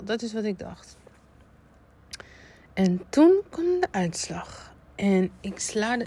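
ordinary talk, nothing marked

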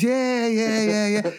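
high, dismissive yeahs; drugged brush-off. Retold or imitated. Patient on morphine brushing off the nurse, high and dismissive. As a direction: wave the nurse off, high and dozy. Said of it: "She came in about ten times".

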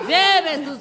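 high, slow hawker's call; hyped fairground barker. Pretend. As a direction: sell the flowers like a street vendor. Mock street vendor hawking flowers.

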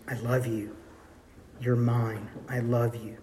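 put-on father whispering love into his child's ear; tender whisper. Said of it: "he whispers in his ear".

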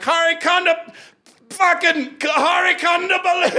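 high announcer call that stumbles; mock emcee fumbling a name; botched stage introduction.

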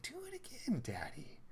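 high breathy whisper, imitating a little girl; awed child's whisper. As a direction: mimic an amazed small child under the breath. Voicing amazed daughter's plea.

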